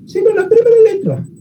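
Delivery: teacher hitting "primera" hard and high; stress on primera. Shown as a demonstration. Teacher stressing the contrast to his students: "primera" lifted high and loud. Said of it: "ni siquiera escuchó una palabra completa".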